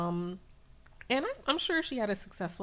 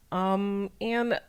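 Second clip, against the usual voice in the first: ordinary delivery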